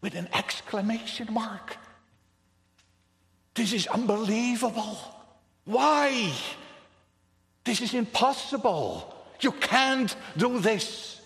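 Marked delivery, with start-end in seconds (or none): 3.5-9.1 outraged cry. Acted. Preacher exclaims in disbelief. 9.4-11.2 You can't do this, loud, higher and slow, each word pressed; outraged protest. Acted. Preacher protesting to the raging nations, loud and slow.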